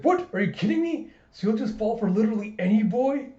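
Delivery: high, loud indignant disbelief; incredulous outburst. Retold or imitated. Becca outraged at Anya, high and incredulous. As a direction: squawk in outrage.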